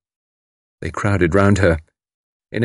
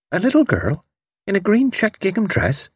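high-pitched dawning question from a servant; sudden recollection; 12 semitones higher than usual. A narrator's reading. Servant asked about a little girl, suddenly remembering. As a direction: ask it high as the memory suddenly comes back. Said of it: "with sudden recollection".

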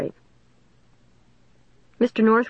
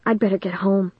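usual speaking voice

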